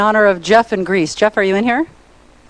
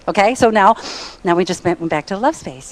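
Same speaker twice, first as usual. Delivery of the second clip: ordinary delivery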